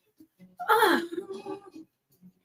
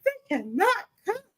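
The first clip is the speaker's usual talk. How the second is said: imitating daughter; 10 semitones higher than usual. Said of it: "She's like".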